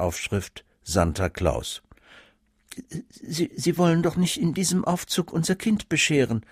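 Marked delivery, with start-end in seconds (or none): 3.1-6.4 higher, stammering aghast question; mother can't believe what she sees. A narrator's reading. Mother confronts the student Santa, aghast and higher. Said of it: "fragte die Mutter entgeistert".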